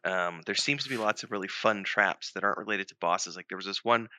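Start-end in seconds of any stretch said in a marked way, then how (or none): none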